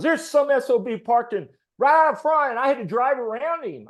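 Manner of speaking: high, loud, indignant complaint; imitating a furious customer griping to staff; angry rant. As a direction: vent like someone who got cut off. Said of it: "came in cussing like a sailor".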